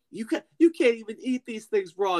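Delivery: high-pitched indignant quote; mock scolding. Hobbit telling off the creature, high mock scolding. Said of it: "is like".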